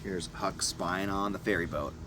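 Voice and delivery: plain reading voice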